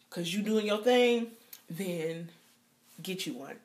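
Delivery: ordinary talk, nothing marked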